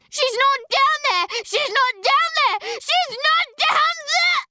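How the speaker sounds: very high, loud repeated scream; girl screaming hysterically at the grave. Acted. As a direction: scream it over and over. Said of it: "She screams".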